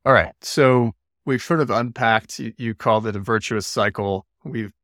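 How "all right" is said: usual speaking voice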